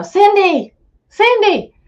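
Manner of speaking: calling out loud and high across camp; hollering. Retold or imitated. Calling his sister across the woods, loud holler. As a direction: yell the name to carry far. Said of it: "I'm hollering at Cindy".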